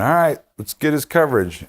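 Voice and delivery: calling out. Retold or imitated. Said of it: "And they went".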